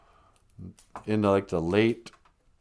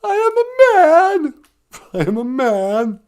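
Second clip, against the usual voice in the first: hammed-up Elephant Man; 10 dB louder than usual. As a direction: overplay the famous line, half laughing.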